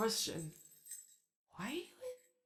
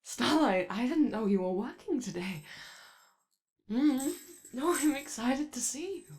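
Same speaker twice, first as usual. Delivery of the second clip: excited greeting; 5 semitones higher, 8 dB louder than usual. Acted. Greeting Starlight warmly.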